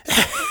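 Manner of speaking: high, short mocking laugh; cruel laugh. Fisher laughing at Seffi, cruel high laugh. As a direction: cackle at her helplessness. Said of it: "Fisher laughed".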